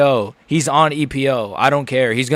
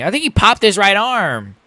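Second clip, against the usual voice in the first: mocking Rogan; 6 semitones higher than usual. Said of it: "is what Rogan is saying".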